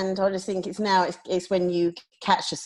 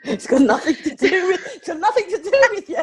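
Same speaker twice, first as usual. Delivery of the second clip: spoken through laughter, much higher; laughing; 4 dB louder than usual. Real, not acted. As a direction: blurt it out while cracking up, faster at the end. Mock reply through laughter.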